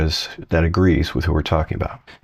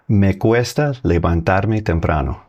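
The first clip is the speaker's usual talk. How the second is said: slow Spanish answer; slower than usual. Shown as a demonstration. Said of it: "Okay, you got it?".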